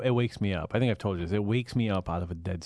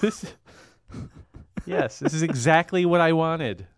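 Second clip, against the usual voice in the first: sarcastic; 5 semitones higher than usual. Pretend. Sarcastic gift thanks.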